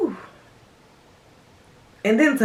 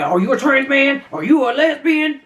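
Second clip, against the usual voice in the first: higher-pitched imitation of nosy questioning; mock eager questioning; 6 semitones higher than usual. Pretending to ask people out while seeking love, mock eager. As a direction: put on a high voice for pretend questions. Mimics asking strangers their identity.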